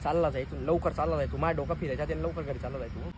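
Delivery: under-the-breath threat to the neighbour; quiet threat. Acted. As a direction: hold the anger in. Warning before losing temper.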